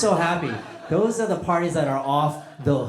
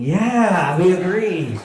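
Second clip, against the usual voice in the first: loud, raised-pitch mock cheer; emphatic agreement; 4 semitones higher, 10 dB louder, much slower than usual. Hungry guests silently backing the grumpy man, loud emphatic agreement. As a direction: belt out the whole crowd's hearty assent. Crowd's thought voiced after the outburst.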